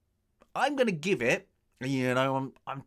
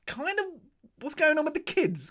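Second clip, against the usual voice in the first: mock baffled viewer. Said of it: "you kinda go".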